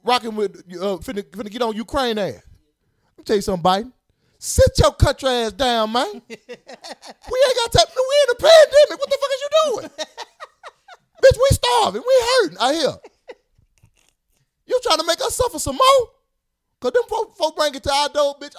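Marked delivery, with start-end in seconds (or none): 4.4-9.9 high-pitched comic indignation; mock outraged rant. Citizen telling off Biden, mock outraged. 11.2-18.5 high, hammed-up indignant pleading; mock desperate complaint. Citizen complaining to Biden, mock desperate.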